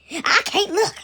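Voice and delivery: lightly acted refusal to watch; appalled ancestor. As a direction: plead upward in mock horror. Appalled ancestors pleading with God.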